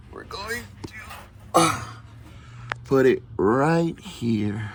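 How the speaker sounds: strained voice, working on back; lying under a car. Real, not acted. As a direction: talk while wedged under car. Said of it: "I'm under the car".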